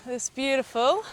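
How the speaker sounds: light mock Kiwi accent; a hint of New Zealand vowels. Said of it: "You're doing the Kiwi accent again".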